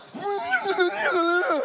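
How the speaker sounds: high, loud, held laugh; a long high laugh that won't stop; high strained laugh. Real, not acted. Preacher laughing as he recalls the chokehold, high and loud.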